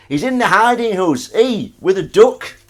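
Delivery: loud high Northern delivery, "duck" as "dook"; slow Northern drawl on "with a duck"; Northern English voice. Shown as a demonstration. Showing chat how the line sounds, loud Northern. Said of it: "That's what that sounds like".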